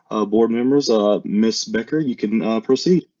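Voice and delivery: ordinary delivery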